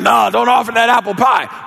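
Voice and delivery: high, hammed-up kid's plea; mock complaining. Kids begging Mama to keep the pie, high mock complaint. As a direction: object like a child losing dessert. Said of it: "We were complaining".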